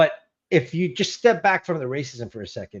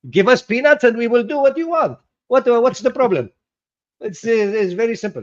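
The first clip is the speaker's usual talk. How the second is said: higher, louder mock Arabic accent, hammed up; 7 semitones higher, 6 dB louder than usual. Mimicking Algerian workers for the co-host, in a mock Arabic accent. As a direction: put on a jokey Arabic accent as a cheap foreign worker. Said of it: "That was me doing the Arabic accent".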